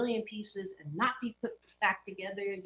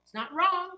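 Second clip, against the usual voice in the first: "wrong" punched much higher and louder; stress on wrong; 10 semitones higher, 7 dB louder than usual. Real, not acted. Coach insisting to viewers that the phrase isn't wrong, emphatic. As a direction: hit "wrong" hard.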